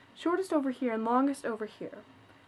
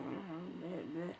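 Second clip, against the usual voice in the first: much softer, lower mock disgust; retold grumbling mom; 5 semitones lower, 14 dB quieter, much slower than usual. Mom at the register, much softer and disgusted. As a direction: mumble it with distaste. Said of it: "she's kinda like".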